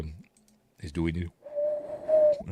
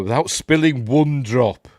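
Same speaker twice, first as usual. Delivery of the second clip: louder, raised, slow emphatic delivery; mock solemn instruction; 5 semitones higher, 7 dB louder, slower than usual. Quoting the knife test, mock declaiming. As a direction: declaim it slowly as a stern rule.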